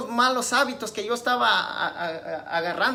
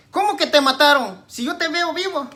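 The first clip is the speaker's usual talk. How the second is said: acted incredulous father, raised pitch; padre incrédulo; 7 semitones higher, 7 dB louder than usual. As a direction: challenge the child in disbelief, high-pitched. Padre incrédulo interroga al niño. (imitando a un padre incrédulo)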